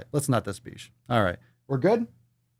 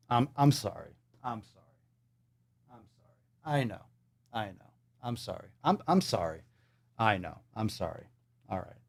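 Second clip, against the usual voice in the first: much softer, low, murmured sorry; quiet remorseful sorry; soft apology; 4 semitones lower, 8 dB quieter than usual. Real, not acted. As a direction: barely voice it, ashamed. Quiet remorse after outburst.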